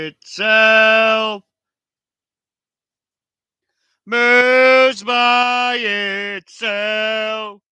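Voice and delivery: very slow, drawn-out echoing shout; cave echo. Shown as a demonstration. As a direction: stretch each word out like a cave echo. Streamer plays with mic echo.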